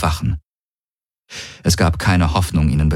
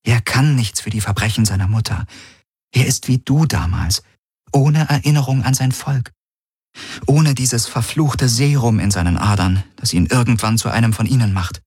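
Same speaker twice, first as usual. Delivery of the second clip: higher-pitched woman's voice; pleading Kirai; 5 semitones higher than usual. Acted. Kirai speaks up for the baby to Galen, higher and pleading. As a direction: plead the child's case in a lifted voice.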